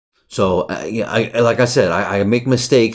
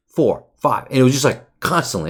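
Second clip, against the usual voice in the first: ordinary talk, nothing marked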